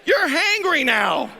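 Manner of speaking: high, loud comic outrage; hangry. Retold or imitated. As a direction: play up the hangry fury. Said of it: "I'm hangry".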